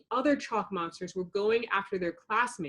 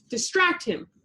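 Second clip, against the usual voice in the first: higher, urgent call; yelled; 9 semitones higher than usual. A narrator's reading. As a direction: yell the order across the fight. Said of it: "Buttercup yelled".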